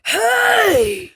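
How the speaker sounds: sudden high, loud shout; guttural shout. Retold or imitated. Flatmate scaring a burglar, sudden high loud shout. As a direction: bark a startled Hey!. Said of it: "a guttural sound that was like".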